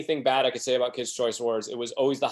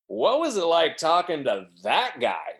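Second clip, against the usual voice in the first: slow, higher, sneering question; derogatory sneer; 5 semitones higher, 3 dB louder, slower than usual. Retold or imitated. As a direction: look down on him as you ask. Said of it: "Like, all derogatory".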